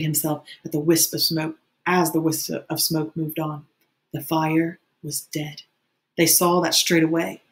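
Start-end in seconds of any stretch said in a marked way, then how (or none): none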